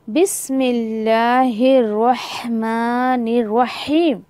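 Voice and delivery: slow बिस्मिल्लाहिर्रहमानिर्रहीम with each syllable drawn; careful recitation. Shown as a demonstration. Teacher demonstrating the tasmiya to students, slow recitation. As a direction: chant it slowly and clearly. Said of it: "यहाँ पर भी अक्सर लोग गलती".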